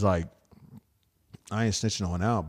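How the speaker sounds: ordinary talk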